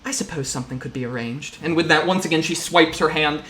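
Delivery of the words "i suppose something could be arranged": lightly voiced businesslike mother figure, choosing words carefully; a hint of a measured, formal matriarch; Mum, discreetly accommodating. Game master plays Mum.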